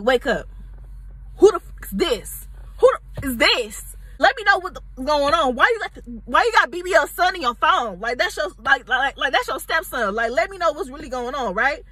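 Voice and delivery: angry confrontation. Retold or imitated. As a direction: get in his face about the stepson. Confronting him over the phone.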